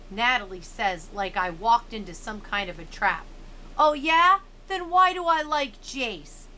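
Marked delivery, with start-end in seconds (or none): 3.8-6.4 triumphant comeback. A narrator's reading. Narrator springs the comeback.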